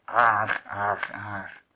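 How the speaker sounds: growling echo dying away; growled echo. A narrator's reading. Stones answering the wolf's growl, fading growled echo. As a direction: growl back the last syllable, fading. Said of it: "zevenmaal de gromde de antwoord".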